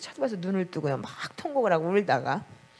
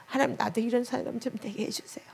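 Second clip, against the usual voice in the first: higher, softer pleading prayer, retold through tears; tearful prayer; 6 semitones higher, 3 dB quieter than usual. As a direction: plead with God as if weeping again. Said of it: "눈물로 통곡을 하면서". (울먹이며 높게 드리는 간절한 기도)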